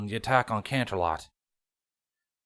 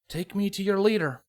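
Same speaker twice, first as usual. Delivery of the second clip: higher, smirking and cheeky; 9 semitones higher than usual. A narrator's reading. Prisoner mocks his changeling captors, smirking. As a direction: toss it off with a smirk. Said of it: "a smirk on his face".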